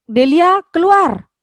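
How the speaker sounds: loud, high, angry shout of keluar; snapping order. Shown as a demonstration. Teacher showing the exclamation-mark version, snapped loud and high. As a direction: snap Delia out of the room. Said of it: "berarti membentak itu sudah".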